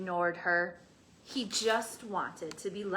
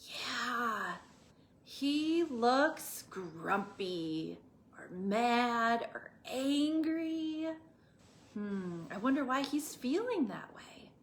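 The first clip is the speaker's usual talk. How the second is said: usual speaking voice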